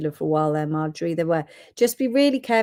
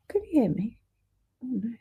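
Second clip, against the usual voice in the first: whispering; 4 semitones higher, 6 dB quieter, faster than usual. Real, not acted. Testing earpiece mid broadcast.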